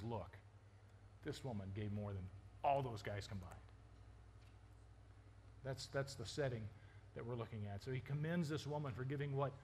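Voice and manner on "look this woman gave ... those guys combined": low, soft voice of quiet authority; quiet correction. Acted. Jesus to his disciples about the widow, quietly correcting. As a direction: drop it down low after the crowd's cheering.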